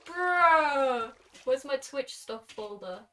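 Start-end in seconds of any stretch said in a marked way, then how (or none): 0.1-1.1 loud, drawn-out disbelief; astonished exclamation. Real, not acted. Streamer reacting to surprise fan art, astonished. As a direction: blurt it loudly and stretch it out.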